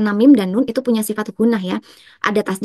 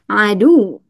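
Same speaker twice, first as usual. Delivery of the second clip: "Adu" louder, leaning on a long "du"; stressed final syllable; 5 dB louder than usual. Shown as a demonstration. Teacher demonstrating naber, loud stressed end of "Adu". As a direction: press hard on the end of "Adu". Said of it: "kita baca dengan naber".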